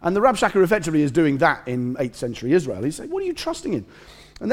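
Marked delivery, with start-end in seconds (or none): 3.1-3.9 very high, mocking question; incredulous challenge. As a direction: toss out a disbelieving taunt in a high voice. Rabshakeh's challenge acted again.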